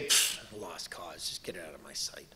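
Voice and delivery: low, scornful hushed dismissal; imagined dismissive god; dismissive whisper. Pretend.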